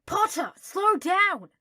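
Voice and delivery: high, slow, loud complaint; protesting. Acted. Draco being dragged, loudly protesting. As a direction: whine at him to slow down.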